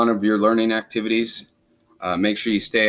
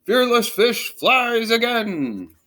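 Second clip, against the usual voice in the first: much higher, slow grand proclamation; triumphant announcer; 10 semitones higher, much slower than usual. Acted. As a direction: boom it like a headline.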